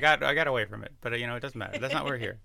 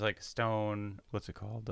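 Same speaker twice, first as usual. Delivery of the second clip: ordinary talk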